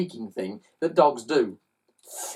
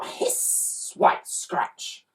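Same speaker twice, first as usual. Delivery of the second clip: usual speaking voice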